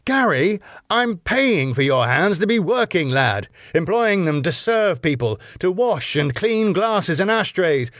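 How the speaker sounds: high, swooping, weary lecture; long-suffering. A narrator's reading. Bar boss lectures his young worker, long-suffering. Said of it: "Max, long-sufferingly".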